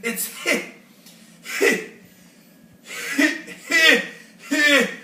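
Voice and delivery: can't get the name out for laughing; a rhino too giggly to say his own name. A narrator's reading. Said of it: "gasped the rhinoceros".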